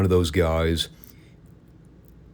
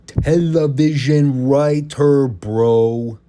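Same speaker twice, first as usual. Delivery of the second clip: high, slow, mocking bro voice, much louder; scoffing Russo; 7 semitones higher, 4 dB louder, much slower than usual. Mocking Russo's defense of his own job, in a high, slow scoffing drawl. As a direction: sneer it out like a dismissive TV writer brushing off the label. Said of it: "he'd scoff at that".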